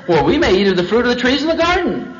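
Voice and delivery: mildly upbeat quoted reply; positive Eve. Acted. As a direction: answer brightly as a confident Eve. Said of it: "See, she was positive".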